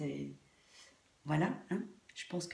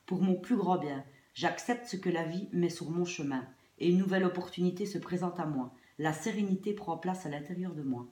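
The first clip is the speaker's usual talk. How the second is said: louder, deliberate affirmation read; 4 dB louder than usual. Acted. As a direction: recite it like a mantra. Reads card affirmation aloud.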